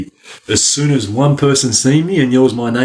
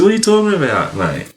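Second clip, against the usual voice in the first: high, incredulous what-are-you-on-about; incredulous denial; 7 semitones higher, faster than usual. Retold or imitated. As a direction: pitch it up in disbelief. Acts out his denial.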